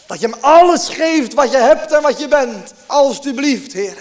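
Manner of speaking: loud, high imploring plea; fervent plea. Acted. Preacher imploring the congregation to give everything, loud and high. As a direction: beg them with full force.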